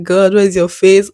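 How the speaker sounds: loud, slow complaint to heaven; mildly hammed-up angry demand; mock anger. Emotional woman challenging God, mock anger.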